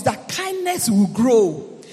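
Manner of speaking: loud, slow, emphatic preaching; preaching at full force. Acted. Preacher building up to his congregation, loud and emphatic. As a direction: hammer each virtue home to the church.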